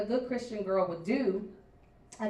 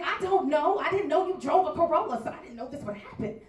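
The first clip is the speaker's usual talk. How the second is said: flustered reply; 6 semitones higher, 4 dB louder than usual. Retold or imitated. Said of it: "And I said".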